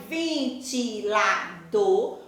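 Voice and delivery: "ventilador" very slow and low, ven-ti-la-dor; syllable by syllable. Shown as a demonstration. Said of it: "na hora que eu pronuncio". ("ventilador" grave e bem devagar, separando as sílabas)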